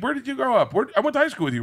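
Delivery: high, eager female voice; excited woman fan. Retold or imitated. Woman at the airport lounge asking him, high and eager. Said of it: "She's like".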